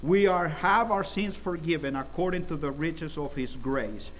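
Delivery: normal talking voice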